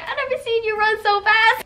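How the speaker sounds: high-pitched excited quote; squeaky retold amazement; amazed onlookers. Quoting amazed friends after the escape.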